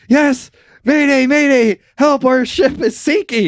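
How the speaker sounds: high, loud mock mayday; distress call. Sinking sailors answering the coast guard, high mock panic. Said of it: "they're like".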